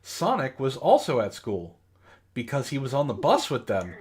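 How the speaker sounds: usual speaking voice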